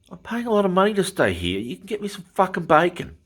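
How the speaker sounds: replaying the fury of demanding bacon, pitched well up; retold angry outburst. To the hotel waiter over missing bacon, a high indignant retold rant.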